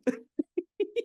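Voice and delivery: high shrieking giggle; very high squealing laugh. Real, not acted. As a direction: squeal with delight. Co-host laughs at spoiler.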